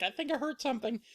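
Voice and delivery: high, small pained voice; Goldilocks hurting. Acted. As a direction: squeak it out, a little hurt. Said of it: "rolls out of it like".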